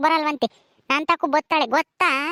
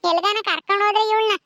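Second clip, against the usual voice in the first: ordinary talk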